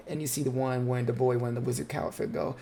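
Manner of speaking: ordinary talk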